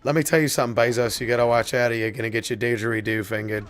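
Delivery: mock warning.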